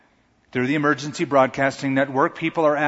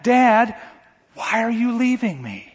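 put-on high loud cry of a child calling for his dad; 9 semitones higher, 3 dB louder, slower than usual. Son crying out to his leaving father, high and loud. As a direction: call out high and loud, desperate. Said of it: "you hear your son crying out".

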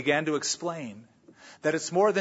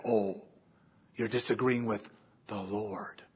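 mock pious reproach; 6 semitones lower, 6 dB quieter than usual. Mocking a self-righteous false prophet.

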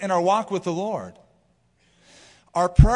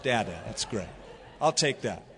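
fond father; 7 semitones lower, 5 dB quieter than usual. Retold or imitated. Father accepting baby word.

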